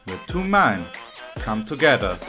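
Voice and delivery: ordinary delivery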